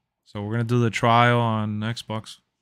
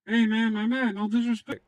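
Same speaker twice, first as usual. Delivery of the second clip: high put-on voice; 8 semitones higher than usual. Mock greeting in put-on voice.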